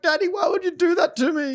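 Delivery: put-on high, wounded little girl; hurt child. Dad voicing his daughter's reproach, high child voice. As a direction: plead like a betrayed kid. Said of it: "she was like".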